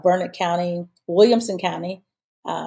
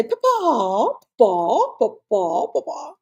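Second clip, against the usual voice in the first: lightly voiced "Papa" repeated with rising tune; "Papa" called out again and again, each one lifting; imitating Alvin; 5 semitones higher, 3 dB louder, slower than usual. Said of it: "you'll hear him say".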